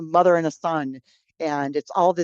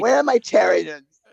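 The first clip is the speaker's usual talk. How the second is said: smoker's voice; 2 semitones higher, 6 dB louder than usual. Retold or imitated. Smoker mom voice continued.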